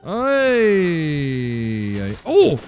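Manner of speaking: loud high cry of fright; panicked hey; startled yell. Real, not acted. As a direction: caught off guard, yell. Jump scare in horror game.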